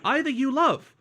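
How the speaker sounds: mock word-for-word French gloss; mock robotic translation. Shown as a demonstration. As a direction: read it like a clunky literal translation.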